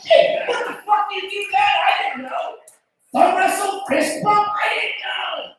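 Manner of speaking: high, loud flustered babble; panicked shouting. Retold or imitated. Boyfriend mistook father's hand for thumbs up, panicked shouting. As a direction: blurt excuses at a shout.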